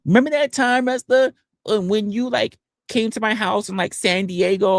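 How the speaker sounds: high-pitched imitation of a reminiscing caller; mock eager accuser. Accuser reminding streamer of San Diego, high mock voice.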